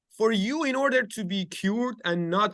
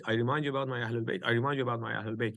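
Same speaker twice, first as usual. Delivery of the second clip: faster softer echo; 6 semitones lower, 5 dB quieter, much faster than usual. Shown as a demonstration. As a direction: repeat it quicker and softer, fading. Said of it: "Three times he said".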